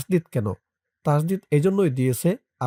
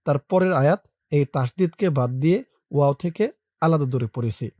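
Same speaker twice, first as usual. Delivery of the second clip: ordinary talk, nothing marked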